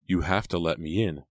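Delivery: ordinary delivery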